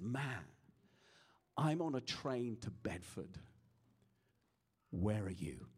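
low, slow, hushed voice of God; whisper of heaven. Retold or imitated. God speaks in the preacher's thoughts at night, hushed. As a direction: speak softly into someone's thoughts, leaving long pauses. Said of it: "I felt the whisper of heaven".

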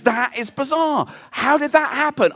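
mock bewildered question; puzzled Peter. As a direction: play the mystified friend. Peter puzzled at dead battery.

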